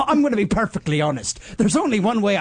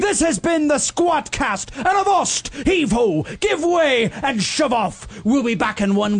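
pirate captain; 8 semitones higher than usual. Acted. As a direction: hail the crew like a captain.

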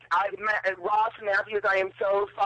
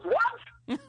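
much higher, incredulous "What?"; retold baffled reaction. Caller retelling the coworker hearing the channel, incredulous. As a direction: mimic a coworker's squeaked disbelief.

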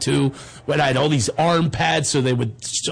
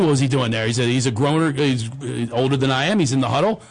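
normal talking voice

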